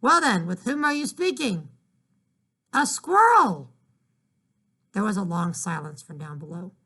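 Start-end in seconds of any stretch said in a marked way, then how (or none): 0.0-1.7 high, loud call upstairs; shouting back. Acted. Mother calling up to her daughter, loud and high. 2.7-3.7 high, loud yell; shouting. Acted. Girl yelling her answer down to her mother.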